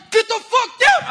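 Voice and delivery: loud, high-pitched cop's command; acted urgent order. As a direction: shout it like a cop clearing a room. Acts out cop ordering him down.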